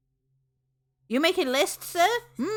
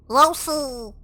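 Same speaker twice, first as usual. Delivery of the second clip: a squeaky cartoon-style call of the name, much louder; silly put-on voice; 4 semitones higher, 7 dB louder than usual. Streamer shows off one of her many voices to chat. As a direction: pop the name out in a goofy character voice. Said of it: "I have many voices".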